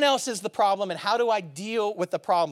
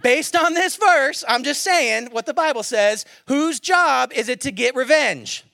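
louder second ask; 4 semitones higher, 7 dB louder than usual. Shown as a demonstration. Pastor asks the congregation again, louder and higher. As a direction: push the question louder to wake the room. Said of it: "you're not convinced. Let's try again".

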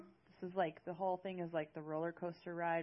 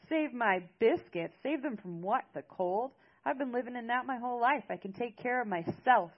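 loud, higher-pitched indignant retort; yelling back; 6 semitones higher, 9 dB louder than usual. A narrator's reading. Britt defying Maris in their quarrel, yelling back. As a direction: yell it back, outraged and defiant. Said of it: "I yelled back at him".

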